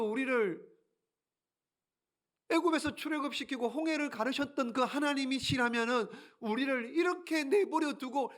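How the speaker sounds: high, aggrieved protest to God; complaining Gideon. Acted. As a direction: argue with God, hurt and indignant. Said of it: "따지는 거예요".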